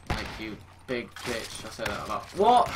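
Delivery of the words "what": high, loud, rising yelp; sudden startled exclamation; shocked. Real, not acted. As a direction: blurt it out in disbelief. Startled by the game.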